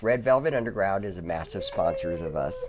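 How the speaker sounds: ordinary delivery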